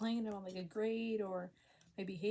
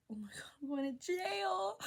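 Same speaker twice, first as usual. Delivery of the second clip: very high, squeaky dread; dramatised panicky yelp; mock panic; 10 semitones higher than usual. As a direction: squeal it in hammed-up terror. Retold panic as cop knocks.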